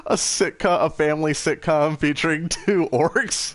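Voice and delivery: high laughing voice. Real, not acted. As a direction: squeal it through laughter. Joking while laughing.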